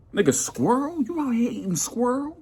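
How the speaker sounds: high incredulous squeal; stunned friend reacting to squirrel; shocked disbelief.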